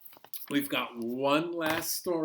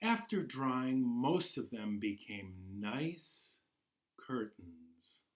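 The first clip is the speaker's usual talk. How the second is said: gentle storybook narration, unhurried; reading to children. Acted. Teacher reading Ghosts in the House to class, storybook voice. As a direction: draw the little ones into the tale. Reads picture book to children.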